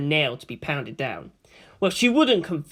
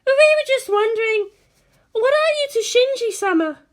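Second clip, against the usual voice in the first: much higher, timid schoolgirl voice; hesitant question; 4 dB louder than usual. A narrator's reading. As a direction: ask shyly, not sure you should. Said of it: "asked hesitantly".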